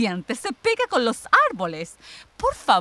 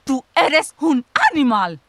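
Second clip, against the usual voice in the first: loud, higher furious insult; angry outburst; 4 semitones higher, 5 dB louder than usual. Acted. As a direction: spit the insult at her. Sister insulting her.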